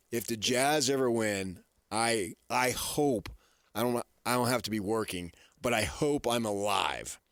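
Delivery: normal talking voice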